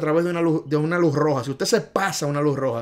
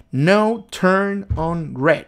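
slow drill; 3 semitones higher, 4 dB louder, much slower than usual. Shown as a demonstration. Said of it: "esta es la señal".

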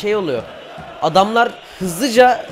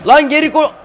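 high, loud order cut short; urgent yell; 7 semitones higher, 7 dB louder than usual. Real, not acted. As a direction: yell at a player to get back. Orders player back.

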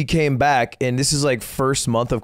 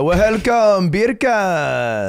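[name] patois; 5 semitones higher, much slower than usual. Retold or imitated. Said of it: "How does [name] speak?".

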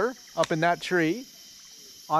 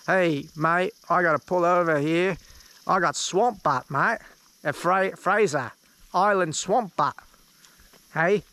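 mock Australian accent; slower than usual. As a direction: imitate an Aussie mate, drawn-out swamp butt. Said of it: "He'd say it literally just like that".

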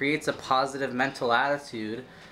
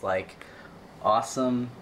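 ordinary talk

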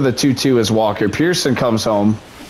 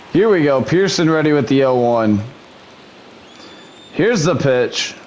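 plain; 3 semitones higher than usual. Acted.

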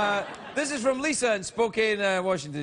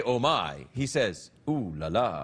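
much lower, a hint of a French purr on Ooh la la; mock French Takei; 10 semitones lower, slower than usual. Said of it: "he doesn't say".

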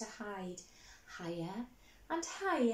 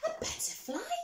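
much higher disbelieving question; astonished. Acted. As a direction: gasp it in wonder.

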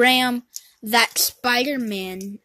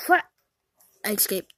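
normal talking voice

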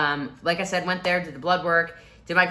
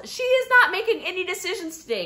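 high, mock yell of the husband; retold yell; 4 dB louder than usual. Husband yelling at the doctor, retold high yell. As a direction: shut the doctor down. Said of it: "And Aviram yells".

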